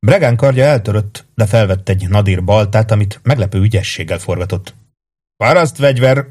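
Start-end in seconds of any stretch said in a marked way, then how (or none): 5.4-6.3 higher-pitched, lightly acted shout; mild shout. A narrator's reading. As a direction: call it out over a fight, without full force. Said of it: "kiáltotta Gilad". (visszafogott kiáltás)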